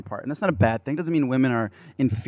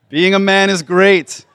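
loud, high and slow; loud proclamation; 7 semitones higher, 9 dB louder than usual. Real, not acted. Preacher proclaiming manhood to the crowd, slow loud. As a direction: declare it big and slow.

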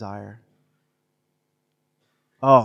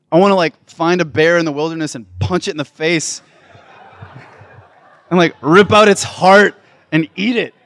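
louder, higher mock tough guy; mock macho bravado; 4 semitones higher, 8 dB louder than usual. Preacher mocking nail salons in a louder macho voice. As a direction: ham up the manly disgust. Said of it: "And I'm like".

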